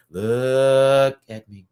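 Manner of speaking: very slow, stretched-out "LOOK at me"; mock-dramatic plea for attention, drawn way out; hammed-up demand. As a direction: drag out LOOK, loud and long. Playful repeat, exaggerated drawn-out take.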